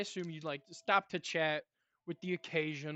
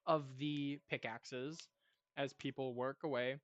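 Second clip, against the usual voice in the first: normal talking voice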